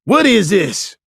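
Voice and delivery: high, loud outraged question; spitting mad demand. A narrator's reading. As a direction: shout in outrage at your arrest. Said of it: "Beach demanded".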